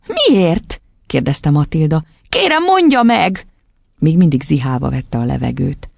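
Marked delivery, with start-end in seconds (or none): 0.1-0.8 put-on high little-girl voice; high, childish question. A narrator's reading. Girl urgently asks why. 2.3-3.5 put-on high, drawn-out little-girl plea; imploring childish voice; pleading child. A narrator's reading. Girl begs teacher.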